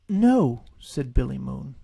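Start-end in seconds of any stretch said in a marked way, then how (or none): 0.1-0.6 firm, higher and louder no. Acted. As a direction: shut it down firmly. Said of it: "very firmly at first".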